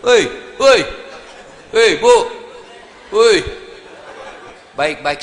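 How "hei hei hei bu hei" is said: loud call for attention. Real, not acted. Preacher calls out to the mothers, loud. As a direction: shout to get the mothers' attention.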